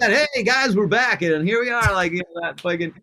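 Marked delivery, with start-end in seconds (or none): 0.3-1.9 high, loud, hyped radio host greeting. Retold or imitated. Podcaster imitating a radio host's hype opener. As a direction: pump it up like a radio host opening the show. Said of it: "there's a reason that radio voice works".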